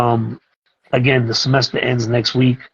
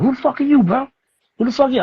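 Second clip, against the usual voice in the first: put-on higher, in-your-face street kid challenge; aggressive kid; 13 semitones higher, faster than usual. Kid confronting his new teacher in class, higher and aggressive.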